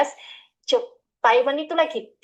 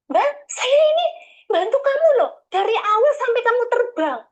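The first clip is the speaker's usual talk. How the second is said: offended agent; 9 semitones higher than usual. Retold or imitated. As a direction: play the hurt agent complaining. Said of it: "kayak ngomong gitu".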